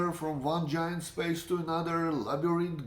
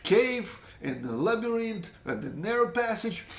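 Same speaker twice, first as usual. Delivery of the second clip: higher, mock furious cursing at the friend; retold cursing at his friend Yakov, voiced higher; cursing in anger; 7 semitones higher than usual. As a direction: vent at him, retelling the rage. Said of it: "I'm cursing him like".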